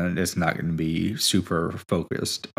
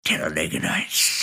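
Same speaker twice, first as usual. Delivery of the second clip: whisper; 3 semitones lower than usual. Acted. Movie reference whisper.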